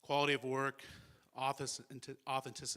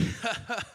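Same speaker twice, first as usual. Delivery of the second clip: high, imitated chuckle; imitated hearty laugh; 9 semitones higher, 5 dB louder than usual. Speaker recalling Jim's usual response, imitated laugh. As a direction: laugh the way an old friend would. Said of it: "And he's like".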